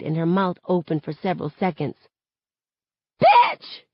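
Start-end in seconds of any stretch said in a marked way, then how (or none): 3.2-3.8 very high, loud outraged shriek. A narrator's reading. Girl just punched in the face, shrieking. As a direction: shriek it in one piercing burst. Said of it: "she shrieks".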